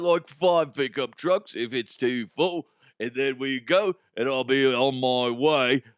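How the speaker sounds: hammed-up cheese thief in British-Australian accent; mock British-Australian accent. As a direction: put on a comic British-Australian buyer's accent. Fake buyer's reply in dialogue.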